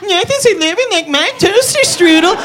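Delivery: squeaky high little dough man; high Doughboy voice. Acted. Comic doing the Doughboy in a high squeak. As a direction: pitch way up for the tiny Doughboy.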